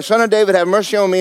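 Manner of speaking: pleading cry, pitched high. Acted. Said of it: "he cried out the more".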